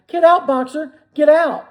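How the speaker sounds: high, loud urgent shout; frantic chorus cry. A narrator's reading. All the animals shouting to Boxer, frantic. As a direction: yell it together in panic. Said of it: "took up the cry".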